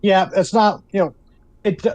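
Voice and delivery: ordinary talk, nothing marked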